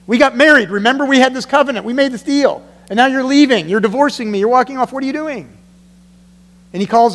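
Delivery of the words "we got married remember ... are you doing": raised pleading voice, a hint of a hurt spouse; God pleading. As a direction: call out like a wounded husband. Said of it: "God calls them out on it and says".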